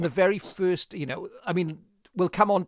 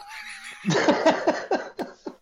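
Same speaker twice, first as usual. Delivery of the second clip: breathless laugh; 5 dB louder than usual. Real, not acted. Laughing at the quoted line.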